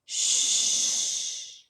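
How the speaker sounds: breathy hushing shh; whisper. Acted. Elephant hushing the pig in a whisper. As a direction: hush him softly. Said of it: "The beast whispered".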